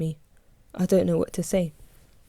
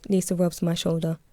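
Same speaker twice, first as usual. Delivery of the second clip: ordinary delivery, nothing marked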